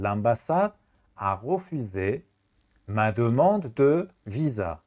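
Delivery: slow, word-by-word model; slow teacher dictation. Shown as a demonstration. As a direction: dictate the sentence slowly.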